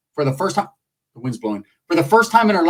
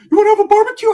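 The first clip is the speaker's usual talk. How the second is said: high squeaky voice for the cousin; squeaky high-voiced cousin calling; 6 dB louder, faster than usual. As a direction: pitch way up as the cousin. Cousin on the phone.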